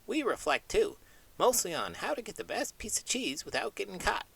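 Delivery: high little mouse voice, cheeky; a cheese-loving mouse cracking a joke; small squeaky mouse bragging to the host. Acted. Mouse puppet Sonny speaks.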